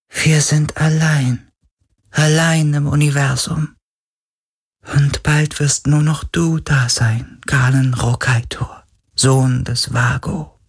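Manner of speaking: high, slow, fading ghostly voice; a lonely spirit speaking its last; mournful spirit. Acted. Spirit mourns being alone.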